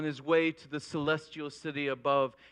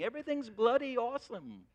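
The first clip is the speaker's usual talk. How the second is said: higher-pitched mock English accent; 7 semitones higher than usual. Man smitten with England before his trip, mock English accent. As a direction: put on a hint of an English accent. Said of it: "he has an English accent now".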